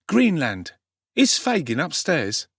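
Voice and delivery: put-on high, loud street-boy voice; cheeky Dodger. A narrator's reading. Dodger joking to the doorman, cheeky. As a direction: brush him off cheekily.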